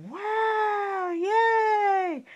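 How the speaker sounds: high, delighted cheer. Acted. Storyteller cheering as Gigi getting in free. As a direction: squeal with joy.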